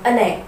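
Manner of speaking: "an egg" with the n joined onto egg; linked. Shown as a demonstration. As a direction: run "an egg" together. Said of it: "और एग नहीं". ("an egg" जोड़कर एक शब्द जैसा)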